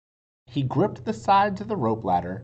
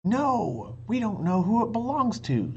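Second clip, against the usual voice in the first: Jack objecting; 8 semitones higher, 3 dB louder than usual. A narrator's reading. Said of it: "said Jack".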